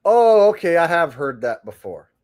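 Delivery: usual speaking voice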